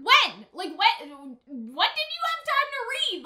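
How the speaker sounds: can't believe he found time to read; incredulous. Real, not acted. Incredulous question at character.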